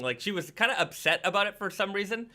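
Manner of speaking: usual speaking voice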